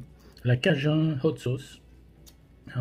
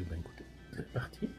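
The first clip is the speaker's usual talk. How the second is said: whispering; 8 semitones lower, 16 dB quieter than usual. Real, not acted. Quiet aside before tasting.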